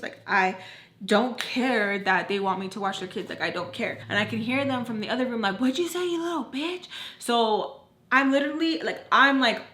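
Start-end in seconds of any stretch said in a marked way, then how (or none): 5.6-6.9 high-pitched mock confrontation; imitated angry challenge. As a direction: mimic an offended mom calling from another room. Retold threat from other room.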